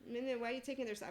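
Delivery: high, loud, quick mock accusation; acted indignant challenge. Acting out a quarrel over taking sides, indignant.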